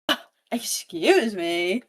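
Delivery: slow, taken-aback excuse me; offended surprise. Retold or imitated. Villain stung by Evie's drinks plan, offended surprise. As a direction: drag it out, affronted.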